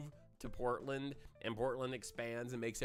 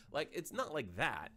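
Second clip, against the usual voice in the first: normal talking voice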